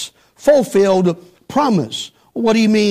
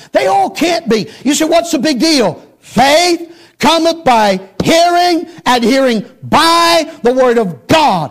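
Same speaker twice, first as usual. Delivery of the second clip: raised, high-pitched pulpit delivery; forceful preaching; 5 semitones higher than usual. Real, not acted. Preacher declaring faith cometh by hearing, forceful preaching. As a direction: hammer it out to the congregation.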